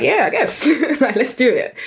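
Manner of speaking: high, giggly retold agreement; laughing yes. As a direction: laugh through a casual yes.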